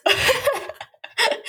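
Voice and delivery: laughing, slowed right down. Real, not acted. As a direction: giggle as you stretch it out. Laughing while retelling.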